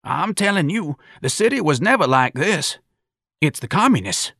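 put-on high-pitched old-timer's grumble; old man airing his suspicion; ranting old man. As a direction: rant to the crowd in a high voice. Old man character rants to crowd.

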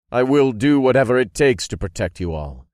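ringing promise of protection; forceful vow. A narrator's reading. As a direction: vow it firmly to the whole room. Master vows to his followers.

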